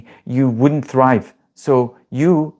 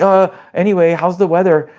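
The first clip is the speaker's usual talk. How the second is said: high, louder, forced cheerful change of subject; awkward nervous deflection; 5 semitones higher, 4 dB louder than usual. Retold or imitated. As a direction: blurt it out to escape the awkwardness. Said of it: "And you're".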